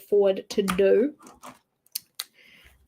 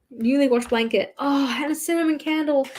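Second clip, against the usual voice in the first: usual speaking voice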